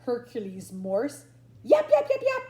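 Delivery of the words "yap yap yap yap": very high, loud yaps; Harry McClary yaps. Acted. Little dog yaps loudly up at the cat. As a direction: yap like a small scruffy dog. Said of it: "said Harry McClary".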